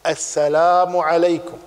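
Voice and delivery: slow, clearly spoken greeting السلام عليكم. Shown as a demonstration. Teacher showing a clearly spoken greeting, slowly. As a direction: draw out السلام عليكم word by word. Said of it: "إذا كان سلامه واضحا".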